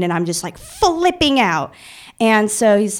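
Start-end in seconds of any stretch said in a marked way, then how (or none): 0.6-1.7 FLIPPING OUT stretched slow and high; drawn-out emphasis. Real, not acted. Telling how she reacted, "flipping out" drawn out high.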